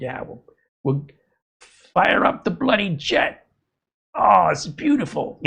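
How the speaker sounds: put-on a drain cleaner firing up his jet, in an overjoyed Australian accent; delighted Australian voice. Vlogger imitating the Australian drain guy with his jet, in a high, happy Australian accent. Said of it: "And he's like".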